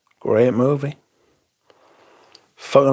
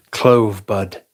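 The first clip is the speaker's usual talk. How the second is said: lightly posh, lower imitation of Jura; mock posh voice; 4 semitones lower than usual. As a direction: say clove bud like a mild posh Englishman. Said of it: "In his posh voice".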